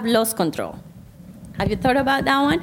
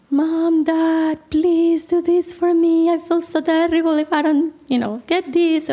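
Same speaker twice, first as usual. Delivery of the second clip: higher-pitched, drawn-out begging; pleading child; 5 semitones higher than usual. Acted. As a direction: plead like a kid working on the parents' sympathy. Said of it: "the child appeals to you".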